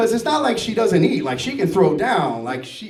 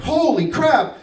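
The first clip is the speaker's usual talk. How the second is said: high, stretched exclamation; retold startled outburst; shocked; 7 semitones higher, slower than usual. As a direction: blurt it in stunned amazement.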